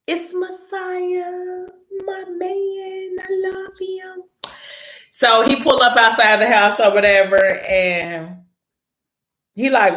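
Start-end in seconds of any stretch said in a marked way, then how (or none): 0.1-4.2 slow, soft and high, melting over him; swooning gush. Real, not acted. Narrator gushing to viewers about Messiah, slow dreamy swoon. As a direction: sigh it out like a fangirl.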